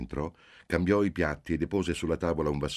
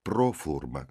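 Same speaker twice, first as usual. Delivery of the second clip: ordinary delivery, nothing marked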